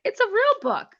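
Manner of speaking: proud giddy author; thrilled. Retold or imitated. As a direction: squeal it with pride. Retold joy at printed book.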